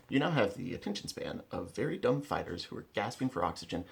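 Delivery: normal talking voice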